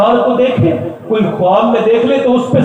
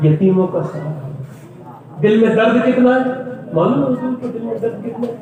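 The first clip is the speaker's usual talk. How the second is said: ordinary delivery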